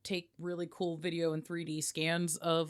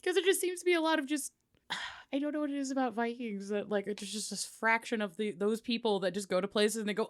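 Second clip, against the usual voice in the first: normal talking voice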